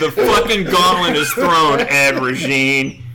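loud, high, through laughter; laughing challenge. Real, not acted. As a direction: throw down the challenge while cracking up. Laughing challenge after the joke.

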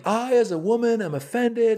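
high mock indignant lady voice; imitating offended woman. Comedian imitating a heckling woman, high and indignant. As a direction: squeak out an offended heckler's complaint. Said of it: "this lady starts yelling".

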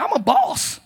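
high, bold imitation of a little girl; young daughter. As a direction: mimic a child declaring herself in charge. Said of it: "She said".

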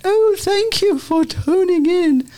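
very high, slow hammed-up English accent; posh English accent. Host imagining the whole podcast in a posh English accent. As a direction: sing it out like an old English lady. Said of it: "in an English accent".

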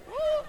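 mock student whoop; high, loud whoop imitating reacting students; high "woo".

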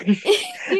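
a burst of high squealing laughter. Real, not acted. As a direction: let a real squealing laugh escape. Laughs at own joke about actress.

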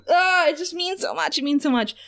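high-pitched, emotional fan gush; overwhelmed gushing. Real, not acted. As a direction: gush as if it's all too much. Fan overwhelmed by the kiss scene.

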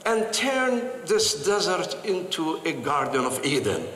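normal talking voice

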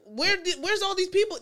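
puzzled townsfolk, lightly acted; imitating confusion. Comic mimics puzzled townsfolk at a meeting, imitating confusion. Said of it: "they're, they're confused".

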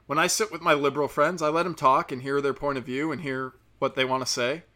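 ordinary delivery

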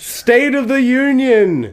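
slow, high, pompous announcement; grand announcer. Pretend. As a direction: proclaim it like a grand title. Grand mock title.

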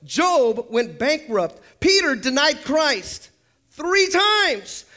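drawn-out, rising roll call of names; emphatic preaching. Real, not acted. Preacher listing flawed Bible figures to the congregation, emphatic and building. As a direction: preach each item with mounting emphasis.

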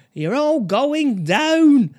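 slow, high and loud mock-menacing line; menacing patient threat. Retelling a patient's classic line as he's dragged away, hammed-up threat. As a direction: drag out the threat like a movie villain. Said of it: "this classic line".

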